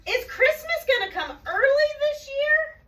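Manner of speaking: very high, loud excited question; eager fox. A narrator's reading. Fox asking Santa about Christmas, high eager voice. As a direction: pitch it way up like a hopeful little animal.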